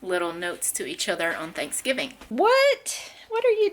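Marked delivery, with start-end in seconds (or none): 2.3-3.7 high, louder surprised "What?". Real, not acted. Mom spotting the toddler with candy, surprised call. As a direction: catch her in the act.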